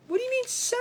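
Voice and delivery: very high, disbelieving squeal; can't believe what he just said; incredulous. Retold or imitated. Incredulous wife to husband.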